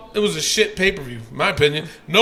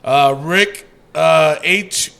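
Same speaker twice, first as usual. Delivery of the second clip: usual speaking voice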